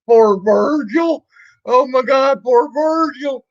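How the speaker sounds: high, slow sarcastic shout of pity; mock pity. Streamer taunting the troll Virgil, mock sympathy shouted high.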